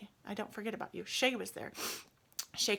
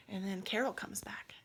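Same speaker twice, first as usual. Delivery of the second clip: plain reading voice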